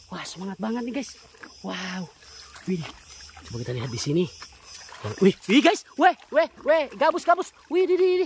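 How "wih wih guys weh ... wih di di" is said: high, loud burst of excitement; quick excited yelps at a sudden sighting; excited discovery. Real, not acted. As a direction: exclaim loudly, pitched well up. Spots another big snakehead fish.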